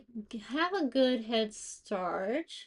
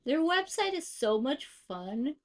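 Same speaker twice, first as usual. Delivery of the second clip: normal talking voice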